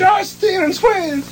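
high mock announcement.

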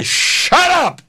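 high, drawn-out reenacted scream; ranting yell. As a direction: cut him off with a screeched retort. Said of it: "I'm ranting and raving at her".

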